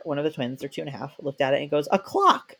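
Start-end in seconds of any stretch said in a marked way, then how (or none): 1.9-2.5 put-on toddler. Toddler naming the spinner.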